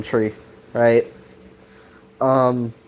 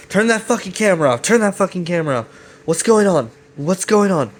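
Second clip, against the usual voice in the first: higher-pitched, rapid-fire demands, mock panic; jolted awake; 7 semitones higher, faster than usual. Alex waking in a boiler room to a camera, mock alarm. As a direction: imitate someone waking with a start, urgent and rushed. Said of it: "all in a jolt".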